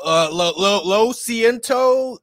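mock-clueless American fumbling his Spanish; playing the ignorant tourist. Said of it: "be in Mexico, get in trouble".

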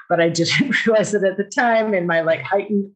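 ordinary talk, nothing marked

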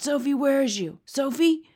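high, loud calling out; anxious calling. A narrator's reading. B F G looks for Sophie, calling out. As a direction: call out for someone hidden.

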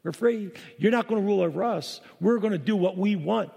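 mocking voice of the defiant world. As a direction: throw it up at God like rebels refusing. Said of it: "the world's looking up at God and saying".

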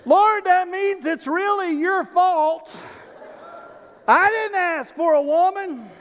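cheeky Adam shifting fault to God; Adam blaming God. Acted.

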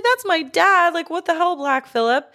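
high, upset imitated outburst; horrified daughter. Podcast host voicing Thomasin's shock at her dad's death, high-pitched. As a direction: squeal it like a shocked teen girl.

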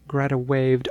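usual speaking voice

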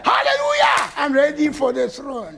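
hammed-up jubilant cry; eager hallelujah. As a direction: play the impatient believer who wants the throne now. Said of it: "many of us would have said".

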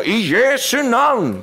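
high, slow, drawn-out command; commanding prayer. Retold or imitated. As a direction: call it out with authority. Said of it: "en autoritetsbønn i Jesu navn".

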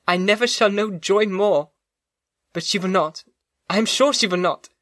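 put-on pleading young woman. Elizabeth pleads for Justine.

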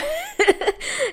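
high bursting laugh; real laugh. Real, not acted. Recalling her panic, she breaks into a real high laugh. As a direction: laugh out at the memory.

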